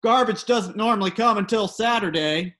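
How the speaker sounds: a neighbor imitated shouting across the street; mock yell of complaint. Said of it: "one of the neighbors shout".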